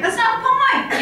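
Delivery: brushing off a friend's offer, mock exasperated and high; exasperated retort. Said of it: "I'm like".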